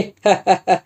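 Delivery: short tipsy laugh; a quick mocking chuckle; drunken laugh. A narrator's reading. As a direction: laugh at him, half drunk. Drunk Subhanrao mocking Nama.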